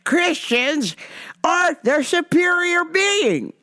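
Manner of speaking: mock zealot lecturing a cashier; preachy Rome guy. Man proclaims superiority.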